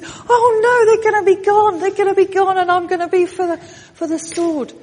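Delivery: high, loud panicked jailer voice; a frightened jailer, voice up high; scared jailer. Acted. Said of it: "he's scared and he says".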